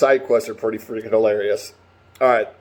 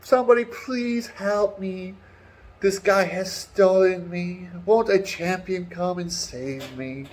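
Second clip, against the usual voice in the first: high, slow pleading cry for help; hammed-up damsel begging from the tower; damsel in distress; 8 semitones higher, slower than usual. Woman in tower begging passersby, high mock plea. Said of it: "She's like".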